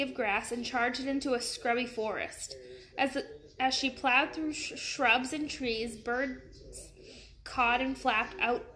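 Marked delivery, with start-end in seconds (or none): none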